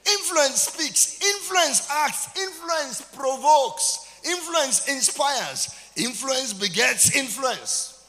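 high, loud, slow chanted refrain; sung-out preacher's litany; preaching at full force. Real, not acted. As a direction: hammer each line out slowly like a rising chant.

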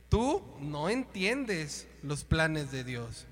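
very slow, each word weighed; slow rebuke. Retold or imitated. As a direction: lay it out slowly and firmly. Jesus rebukes Peter. (reproche muy lento y firme)

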